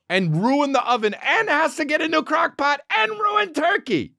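high-pitched comic exasperation; worked-up, high ranting; exasperated. Real, not acted. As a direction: build the list to an indignant peak. Exasperated climax of the rant.

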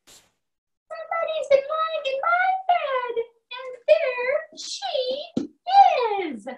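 put-on very high baby bear voice; tiny bear pointing her out. A narrator's reading. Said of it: "Baby Bear's line, high".

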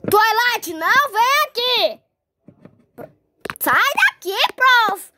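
loud calls to chase her off; loud shooing; calling out. Acted. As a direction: shout after the pony. Chasing pony off.

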